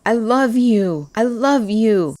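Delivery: slow, high, emotional confession; tearful confession. A narrator's reading. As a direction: let the truth burst out. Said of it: "I repeated over and over".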